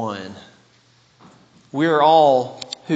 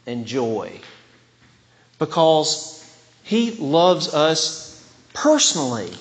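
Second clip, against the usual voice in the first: ordinary talk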